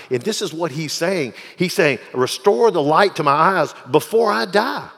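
loud, rising sermon voice; fiery pulpit preaching; preaching at full force. Acted. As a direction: preach it, building the fire.

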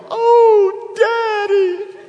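very high, loud tearful Oh Daddy; crying little girl. Retold or imitated. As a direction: break into a child's sob.

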